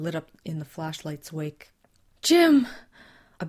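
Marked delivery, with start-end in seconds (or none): 2.2-2.7 high, loud cry of his name. Acted. Susan spotting Jim's blood in the flashlight, loud cry. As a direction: shout his name as you spot him. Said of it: "Susan's cries intensified".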